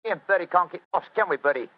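loud, high, cheeky Cockney; jolly Cockney workman brushing off help; Cockney accent. Acted. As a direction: put on hearty Cockney banter. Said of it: "My Cockney accent doesn't compare with yours".

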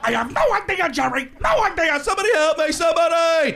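high, loud, frantic comic wail; panicked sitcom sidekick. Acted. Answering Jerry, helpless high-pitched panic.

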